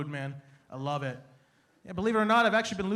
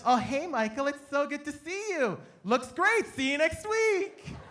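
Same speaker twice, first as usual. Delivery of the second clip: chirpy clinic lady; 4 semitones higher, slower than usual. Retold or imitated. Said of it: "Walk into the place".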